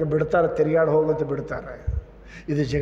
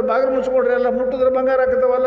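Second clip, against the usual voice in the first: high, loud and fast alarm; frightened shout; 8 semitones higher, 6 dB louder, faster than usual. Acted. Neighbours warning each other about the man, frantic shouting. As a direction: hurry everyone to shut the doors. People slam doors in fright.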